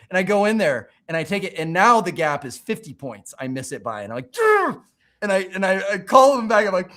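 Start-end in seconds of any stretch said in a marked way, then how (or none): 4.4-4.8 very high, loud "ugh" of exasperation; frustrated groan. Retold or imitated. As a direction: cry out at failing again. Failed second exam.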